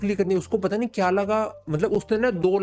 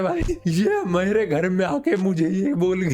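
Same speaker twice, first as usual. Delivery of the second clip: stunned dad; 2 semitones higher, slower than usual. Retold or imitated. Father's stunned inner thought.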